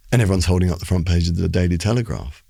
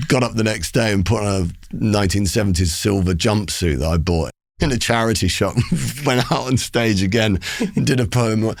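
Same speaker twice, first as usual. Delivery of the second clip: usual speaking voice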